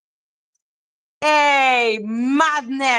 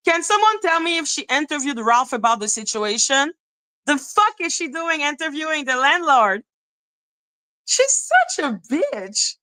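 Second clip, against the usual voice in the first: ordinary talk, nothing marked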